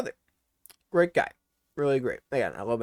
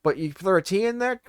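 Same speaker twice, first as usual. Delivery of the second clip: ordinary talk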